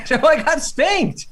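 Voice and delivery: embarrassed laughing admission to a friend. Real, not acted. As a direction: laugh through the confession. Laughing through confession.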